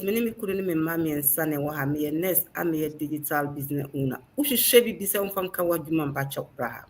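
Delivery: ordinary delivery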